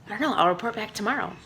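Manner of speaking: ordinary talk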